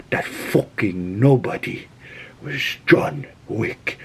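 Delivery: the boss telling his son who he crossed, gravely; menacing mob boss. Retold or imitated. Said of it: "He's like".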